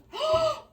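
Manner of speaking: sharp high gasp that breaks into a laugh. Real, not acted. Reader gasps into laughter at her own joke. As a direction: catch the breath, then laugh.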